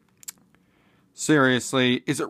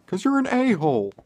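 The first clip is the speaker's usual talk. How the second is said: mocking mom insult; 10 semitones higher, faster than usual. Mom insulting her son.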